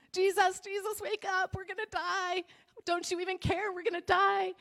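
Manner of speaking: high, frantic cries of terror; panicked disciples. Acted. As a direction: plead like men about to drown.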